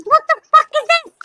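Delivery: high, startled shriek of a question; startled yelp. Retold or imitated. Lemur spooked in the dark, startled high yelp. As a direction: squeal it out like a spooked little animal.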